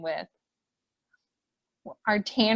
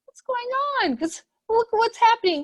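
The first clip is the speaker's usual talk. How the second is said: much higher, startled bear friend voice; a bear noticing something odd, pitched way up; surprised friend; 12 semitones higher than usual. Acted. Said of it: "one of our friends going".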